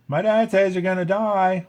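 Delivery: high, loud, taunting sneer. A narrator's reading. Ant boy taunting Dot about Flick, sneering. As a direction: jeer it like a mean little kid. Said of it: "one of the boys sneered".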